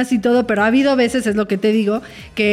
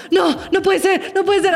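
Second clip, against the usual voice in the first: higher, mock-alarmed disbelief; mock panic; 7 semitones higher than usual. Mother retelling waking up to the baby, mock panicked. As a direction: act out a half-joking morning panic. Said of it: "un día se levanta y es como".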